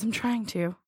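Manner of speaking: small, soft, feeble reply; a meek, understated mock-pathetic mumble. Retelling a date's text to a friend, pathetically meek. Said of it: "It sounded so pathetic".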